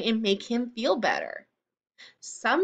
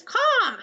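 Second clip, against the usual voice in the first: a sharp high bird caw; a loud, high crow's call; raven caw; 11 semitones higher, 4 dB louder than usual. Acted. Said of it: "Raven says".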